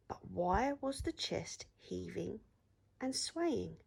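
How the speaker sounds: usual speaking voice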